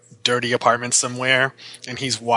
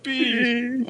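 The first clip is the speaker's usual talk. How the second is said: high, tearful wail played for laughs; mock crying; 11 semitones higher than usual. Co-host joins in imagining the weeping cameo, mock crying. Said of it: "he's been crying for days".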